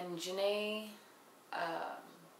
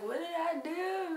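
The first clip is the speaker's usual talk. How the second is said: put-on high, slow, whimpering drunk voice; high crying plea; mock crying drunk; 10 semitones higher, slower than usual. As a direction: whine it like a sobbing lost drunk. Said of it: "the little drunken monkey is pretty much like".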